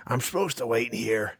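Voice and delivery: lightly voiced dwarf, grudging; grudging dwarf mutter. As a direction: say it to himself, unsure. Dwarf hesitates, repeats himself.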